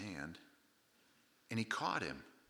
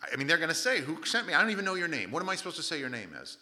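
agitated protest, pitched up and louder; higher, insistent string of excuses; making excuses; 5 semitones higher, 5 dB louder, faster than usual. Acted. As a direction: plead your case like a flustered Moses. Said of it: "Moses starts making his excuses".

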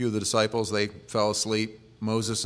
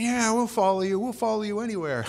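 eager followers; 11 semitones higher than usual. Retold or imitated. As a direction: parody the crowd's naive enthusiasm. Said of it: "people will be saying".

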